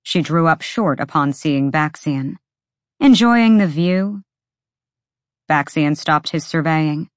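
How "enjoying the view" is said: higher and louder, teasing bite; sarcastic jab. A narrator's reading. Bryce confronts Baxian in her apartment, sarcastic and louder. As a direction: needle him about staring.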